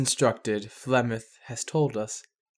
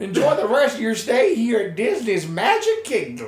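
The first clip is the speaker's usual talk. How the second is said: higher, cheery mock announcer voice with a laugh; park announcer; 7 semitones higher than usual. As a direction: sign off like a Disney ride recording. Mock Disney ride announcement.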